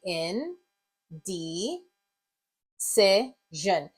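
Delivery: "in, di, si, zhen" with long pauses between; syllable by syllable. Shown as a demonstration. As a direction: give each word part alone: in, di, si, zhen. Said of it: "work on the rest of it".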